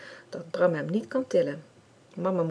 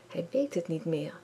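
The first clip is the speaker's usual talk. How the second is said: ordinary delivery, nothing marked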